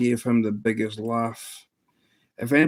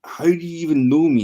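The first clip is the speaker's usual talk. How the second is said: raised, louder mock bafflement; retold bewilderment, pitched up and pushed louder; imitating baffled disbelief; 6 semitones higher, 5 dB louder, faster than usual. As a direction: act out a baffled streamer demanding answers. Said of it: "he got really baffled".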